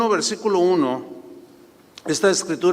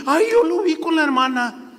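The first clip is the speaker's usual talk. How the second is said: much higher, gossipy church lady; gossiping sister; 10 semitones higher than usual. Retold or imitated. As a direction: tattle like a nosy church gossip. (la hermana chismosa contando lo que vio, voz aguda de chisme)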